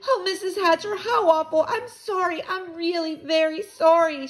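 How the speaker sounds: high-pitched tearful pleading; crying apology. A narrator's reading. Girl apologizing to the boy's mother, crying. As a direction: wail the apology like an upset girl. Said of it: "Sheila cried".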